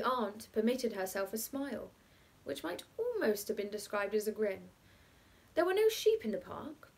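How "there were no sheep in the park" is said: lightly pitched-up bachelor, matter-of-fact; a hint of a playful bachelor; bachelor's reply. A narrator's reading. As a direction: state it plainly to the children, pitched up. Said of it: "said the bachelor".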